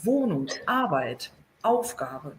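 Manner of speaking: "Wohnung, Arbeit, Aufgabe" slow and low, each hit on its first syllable; first-syllable stress. Shown as a demonstration. Teacher showing where the tonic syllable goes in "Wohnung, Arbeit, Aufgabe", stress at the start. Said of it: "colocar a sílaba tônica sempre no começo".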